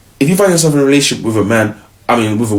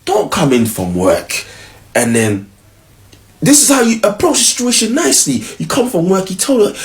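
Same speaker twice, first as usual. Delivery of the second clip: ordinary talk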